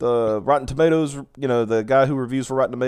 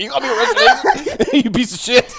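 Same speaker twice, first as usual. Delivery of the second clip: high-pitched imitation of outrage, breaking into laughter; mock outrage; 12 semitones higher, 4 dB louder than usual. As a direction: mimic the indignant rant while cracking up. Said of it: "He's like".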